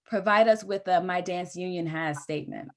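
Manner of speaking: ordinary delivery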